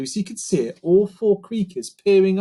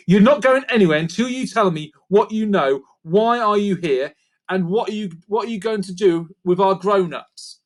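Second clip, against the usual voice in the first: firm demand; 6 semitones higher, 4 dB louder than usual. A narrator's reading. Lucy demanding answers.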